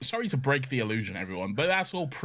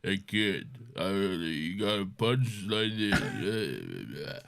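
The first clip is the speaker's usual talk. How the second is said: low, slurred, drawn-out mumble; exaggerated slow, low boxer impression; Sylvester Stallone; 5 semitones lower, much slower than usual. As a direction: mumble it slowly, words barely formed. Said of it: "he is often like".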